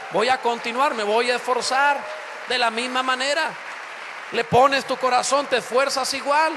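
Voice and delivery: loud, driving sermon climax; full-force preaching. Acted. Pastor exhorting the church, loud and driving. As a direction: urge the congregation on at full voice.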